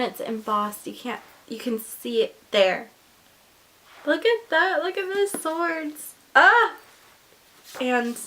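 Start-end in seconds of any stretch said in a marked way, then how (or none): 4.0-6.7 high, rising delight; thrilled fan gushing; excited. Real, not acted. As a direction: gush over the swords. Excited over embossed cover.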